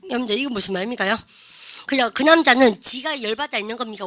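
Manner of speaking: normal talking voice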